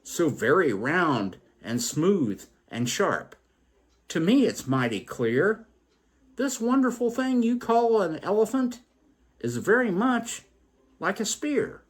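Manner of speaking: high, excited voice for the blind man; voiced blind man.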